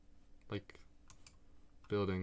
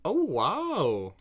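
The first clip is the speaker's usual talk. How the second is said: delighted gasp of wonder; wow, amazed at the view; surprised; 7 semitones higher, 5 dB louder than usual. Real, not acted. Sudden scenic view in game.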